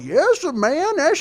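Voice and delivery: high-pitched hammed-up period voice; exaggerated high mock old-film character; old-movie caricature. Comic imitating an old show's caricature, high hammed-up voice.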